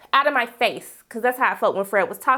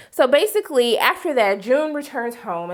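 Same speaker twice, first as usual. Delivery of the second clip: ordinary talk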